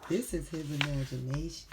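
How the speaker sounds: plain reading voice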